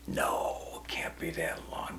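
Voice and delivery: barely voiced whisper; soft, breathy protest to himself; hushed disbelief. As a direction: whisper it in mock dread, trailing away. Stunned disbelief at the answer.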